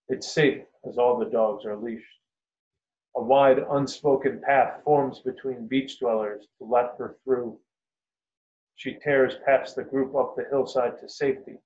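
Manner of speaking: ordinary delivery, nothing marked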